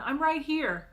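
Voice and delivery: loud high call. Acted. Big sister calling to the kids across the street, loud. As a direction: shout it across the road. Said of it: "I call as they run".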